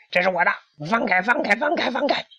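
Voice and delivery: very high, rapid repeated plea; frantic cry. A narrator's reading. Hen chased by an unseen figure, frantic cry. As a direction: cry out desperately, words tumbling fast. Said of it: "小胖墩儿急得大喊".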